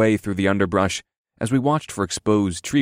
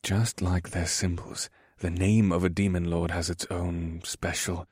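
quiet but determined; 3 semitones lower, 7 dB quieter than usual. A narrator's reading. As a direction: speak softly, weighing the words with resolve. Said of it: "said in a quiet but determined voice".

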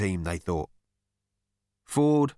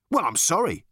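mildly huffy, pitched-up protest; 7 semitones higher than usual. A narrator's reading. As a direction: sound a little put out. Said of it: "said the man huffily".

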